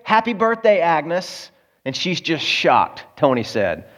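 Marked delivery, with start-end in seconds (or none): 0.0-1.5 high-pitched imitated crowd cheer; retold party yell. Party guests surprise Agnes at the door, mock yelling. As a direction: call it out high, a half-strength yell. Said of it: "and they yell".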